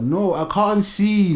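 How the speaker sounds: loud, high, drawn-out protest; exasperated protest. Acted. Answering people who assume glasses mean smart, exasperated protest. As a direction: insist slowly, pitched high and loud.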